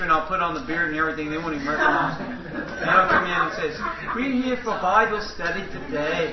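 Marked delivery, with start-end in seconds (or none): 4.1-6.3 lightly faked Dutch accent, laughing; a hint of a Dutch preacher accent. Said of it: "with that little Dutchy accent".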